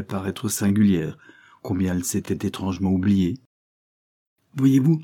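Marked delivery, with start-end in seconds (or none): 4.6-5.0 a quick, high, louder Voyez-vous; hurried apologetic opening; hasty excuse. A narrator's reading. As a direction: blurt it out to cover herself. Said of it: "se hâta-t-elle de dire en manière d'excuse".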